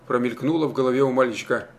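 plain reading voice

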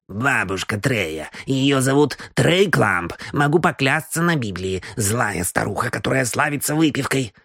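put-on a tipsy old gossip confiding in the gentlemen; old housekeeper. Housekeeper tells the detective about granny Clump, gossiping old woman. Housekeeper Mrs Moore voiced.

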